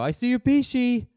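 high, loud singsong teasing; teasing grandma. Retold or imitated. Grandma barging into the shower teasing her grandson, high and loud. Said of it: "And she'd go".

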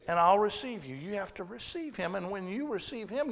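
ordinary delivery

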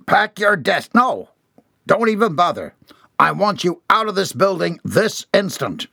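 high, forceful angry commands; furious order. A narrator's reading. Boss throwing out fired employee, furious. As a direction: drive him out word by word.

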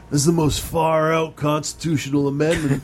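a hint of Shaggy, far-out dude. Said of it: "they had Shaggy reporting this story".

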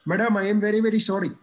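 put-on scolded boy apologising to the driver, meek Indian accent. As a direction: grovel politely in an Indian accent. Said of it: "I had an Indian accent at the time".

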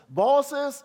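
put-on loud, high call to the children; a preacher's rallying call. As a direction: call them out by name. Calling out each group.

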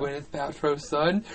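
ordinary talk

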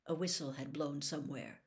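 ordinary delivery

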